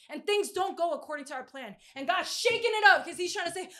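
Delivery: ordinary delivery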